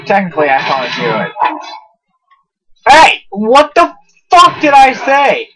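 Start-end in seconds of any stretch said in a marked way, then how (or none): 2.9-5.5 loud, high-pitched outburst; angry yell. Real, not acted. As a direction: scold the companion at full voice. Yelling at troublesome game companion.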